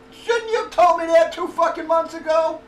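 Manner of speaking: loud, high outraged comeback; indignant retort. Retold or imitated. Patient retelling his comeback to the doctors, indignant and loud.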